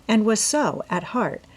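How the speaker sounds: usual speaking voice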